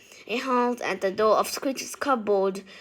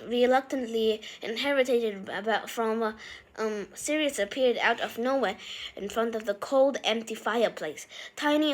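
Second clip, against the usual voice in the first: ordinary delivery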